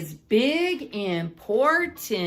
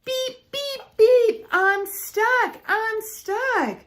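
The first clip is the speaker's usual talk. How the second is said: high, urgent beeps and cries; a little truck calling for help; stuck and calling; 10 semitones higher, 3 dB louder than usual. Acted. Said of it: "cried the little blue truck".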